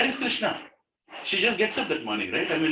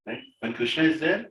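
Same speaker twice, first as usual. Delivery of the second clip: usual speaking voice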